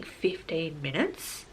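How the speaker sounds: slow, low, dropped to almost nothing in disbelief; incredulous. Retold or imitated. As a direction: let it sink in with dread. Hears the wait is fifteen minutes.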